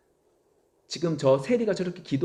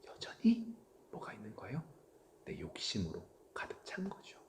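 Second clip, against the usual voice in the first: hushed whisper; 5 semitones lower, 14 dB quieter than usual. Acted. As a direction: whisper it like a secret. Hushed confession of greed. (속삭이는 목소리)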